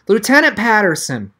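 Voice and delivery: calling out firmly this time. Acted. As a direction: raise the voice and call across.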